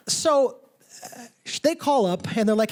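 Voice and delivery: normal talking voice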